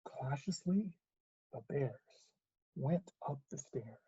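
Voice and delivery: soft, tiptoeing narration; hushed suspense; hushed caution. A narrator's reading. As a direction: narrate it quietly, as if creeping. Bears creep upstairs cautiously.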